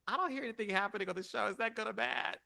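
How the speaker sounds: high, rapid puzzled voice; acted fretful questioning; worried onlooker. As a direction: rush it out high, mock-anxious. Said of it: "You're like".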